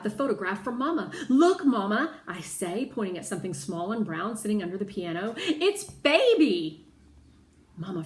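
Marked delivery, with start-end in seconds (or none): 1.3-2.1 higher, louder excited cry; eager child pointing something out; excited call. A narrator's reading. Girl spots the dog excitedly. 5.4-6.9 high, loud and slow; thrilled child naming her dog; excited recognition. A narrator's reading.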